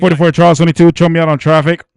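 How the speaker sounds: loud, mock radio call-in; booming imitation of an officer on the radio; imitated radio call. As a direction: belt it out like a unit calling dispatch. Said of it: "the one that goes".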